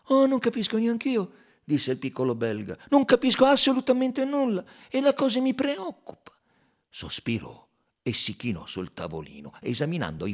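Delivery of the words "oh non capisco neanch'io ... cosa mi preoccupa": raised, louder, brisk detective voice; amused Belgian sleuth pointing out the clue; Poirot's voice. A narrator's reading. Poirot to the doctor about the handkerchief, brisk and raised.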